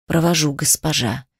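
low, soft growling demon; demonic growl. Acted. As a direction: growl it from deep inside. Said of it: "услышала в ответ рычание".

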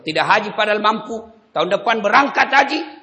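forceful sermon; fiery preaching. Acted. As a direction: preach it loud and high.

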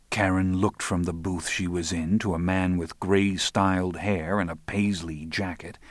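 plain reading voice

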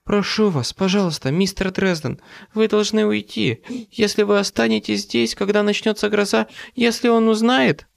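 high, desperate sobbing plea; tearful pleading. A narrator's reading. Monica begs the visitor to leave, bursting into sobs. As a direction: plead in a high voice, hurrying through tears. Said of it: "и разразилась рыданиями".

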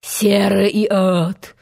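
slow, restrained echo of the captain's oath; Hook imitation. A narrator's reading. Peter retorts in Hook's voice, measured mimicry. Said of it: "возразил ему голос".

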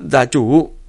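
sudden shout of alarm; loud startled call; alarmed cry. Acted. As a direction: cry out to the clan head in shock. Subordinate cries out to clan head.

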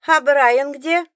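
high, loud call from the door; yelling across the room. A narrator's reading. Child calls from the doorway.

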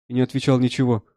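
plain reading voice

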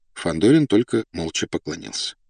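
ordinary delivery, nothing marked

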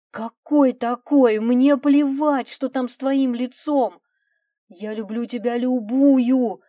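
loud, heated appeal; impassioned pleading. A narrator's reading. Husband insists he loves wife regardless, impassioned pleading. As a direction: argue forcefully through a shut door.

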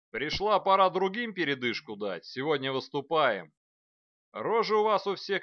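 loud gruff drill commander; gruff space marine. A narrator's reading. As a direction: shout it across the parade ground. Commander addressing the troops. (громкий грубый голос командира)

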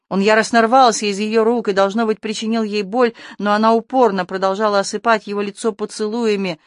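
ordinary delivery

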